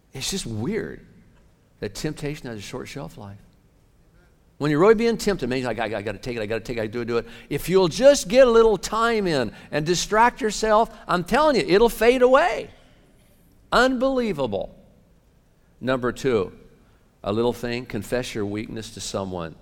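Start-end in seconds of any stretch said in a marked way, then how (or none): 4.6-14.7 loud, high preaching surge; preaching at full force. Acted. Pastor exhorting the congregation, loud and high. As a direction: drive it home like a revival preacher.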